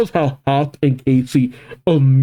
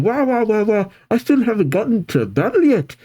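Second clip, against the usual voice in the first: protesting; 9 semitones higher than usual. Acted. Casey cuts off spoilers, ears covered.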